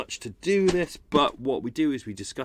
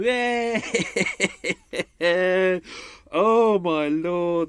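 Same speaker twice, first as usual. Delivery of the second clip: relieved cheer; 6 semitones higher, 7 dB louder, much slower than usual. Real, not acted. Relief after finishing recording.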